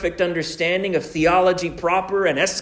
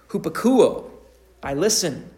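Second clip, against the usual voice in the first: ordinary delivery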